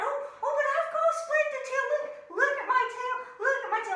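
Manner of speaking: high, loud, fast pleading; high excited pleading; eager bear. Acted. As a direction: insist excitedly on your tail. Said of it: "And the bear went".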